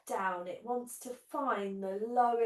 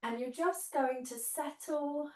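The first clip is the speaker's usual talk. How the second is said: guided meditation. Acted. Leads guided relaxation on mat.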